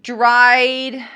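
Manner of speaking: ordinary talk, nothing marked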